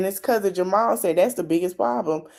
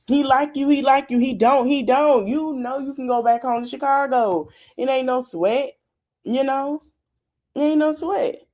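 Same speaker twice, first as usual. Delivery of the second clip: pleading encouragement; 6 semitones higher than usual. Real, not acted. Host pleading with cast member.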